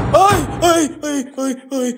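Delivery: sudden high loud yelp; startled high yell at the banging roof. Real, not acted. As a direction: jump at the noise and cry out. Roof noise startles him.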